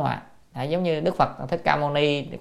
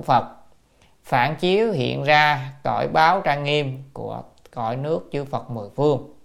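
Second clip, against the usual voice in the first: measured, deliberate reading of written text; formal reading; 4 dB louder than usual. Real, not acted. Teacher reads the translated note to students, measured formal reading.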